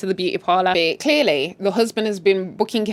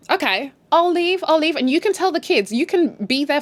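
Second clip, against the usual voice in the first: dramatic wife threat; 6 semitones higher than usual. Retold or imitated. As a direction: play the hurt wife walking out. Voicing wife's threat.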